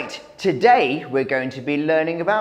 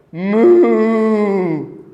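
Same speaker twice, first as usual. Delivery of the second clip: cow moo; 4 dB louder than usual. Shown as a demonstration. Teacher voices cow sound.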